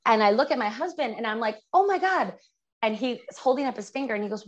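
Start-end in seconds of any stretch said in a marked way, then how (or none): 1.7-2.3 high-pitched, stunned exclamation; shocked. Retold or imitated. Wife to husband on seeing the license plate, shocked. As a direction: gasp it out as a retold shock.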